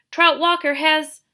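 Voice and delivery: loud high urgent outburst. Acted. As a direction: blurt it out urgently. Catherine cut off, urgent.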